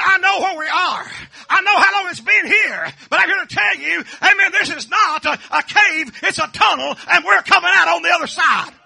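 loud, driving revival preaching; full-force preaching. Acted. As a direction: preach it out to the whole congregation.

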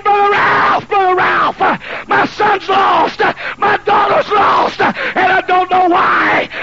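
high, loud, slow crying plea to the preacher; a desperate parent begging the pastor; wailing parent. Retold or imitated.

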